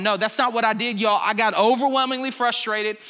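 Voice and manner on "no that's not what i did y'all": ordinary talk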